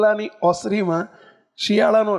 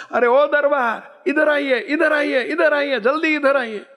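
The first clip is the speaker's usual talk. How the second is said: urgent call; 6 semitones higher, slower than usual. Retold or imitated. Old woman calling neighbour urgently.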